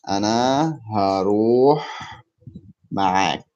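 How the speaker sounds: "أنا هروح معك" word by word, pausing before "معك". Shown as a demonstration. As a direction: stretch "هروح", pause, then finish with "معك". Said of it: "How can I say".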